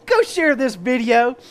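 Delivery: loud, high, hyped-up; excited shout. Real, not acted. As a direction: shout it out with glee. Hyped signoff after joke.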